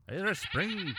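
put-on Irish Spring sung out high like an old ad jingle; mock soap commercial. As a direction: pitch it like a cheesy TV soap ad.

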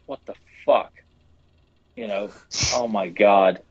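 normal talking voice

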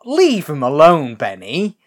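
loud, high, drawn-out telling-off; mock scolding. Viewer defending Leatherface from Benny, mock scolding. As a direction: jump to his defence loudly.

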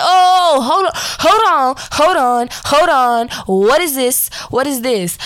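yelling in sudden fright; panicked shout. Retold or imitated. Seeing green mist pour from her sister's mouth, panicked shouting.

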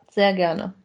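ordinary talk, nothing marked

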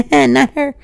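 high, loud, laughed-out words; laughing. Real, not acted. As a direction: crack up while saying it. Laughs while repeating own joke.

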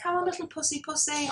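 high, soft cat call; mimicked pet-coaxing voice; imitated care client. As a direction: coo softly to a cat. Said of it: "I had a client that would just be like".

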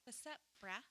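laid-back bro greeting, a hint of dude; frat bro. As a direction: toss it off like a chill frat guy. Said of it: "I'm like, brah".

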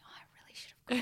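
whispered wistful regret; a longing little whisper to herself; regretful whisper. Retold or imitated. Retold regret over sample vials.

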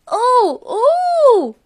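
put-on child yelling. A narrator's reading. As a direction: yell high like a thrilled child. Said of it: "yelled the little boy".

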